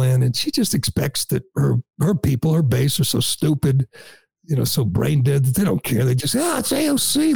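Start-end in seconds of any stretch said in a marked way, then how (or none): 6.4-7.3 high gushing mock fan voice; starstruck fan. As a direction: gush like a dazzled fan, pitched way up. Mocking her starstruck fans.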